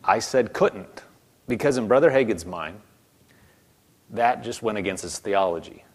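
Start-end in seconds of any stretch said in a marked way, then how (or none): 0.0-1.0 slow, firm emphasis on "couldn't"; insistent correction. Retold or imitated. Jesus answering no to the man, slow firm stress on "couldn't". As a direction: repeat it deliberately, landing on "couldn't".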